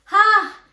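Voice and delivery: loud, high "Ha!"; exasperated outburst. Retold or imitated. Worked up after a friend's blame, exasperated yelp. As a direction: let out a sudden cry of frustration.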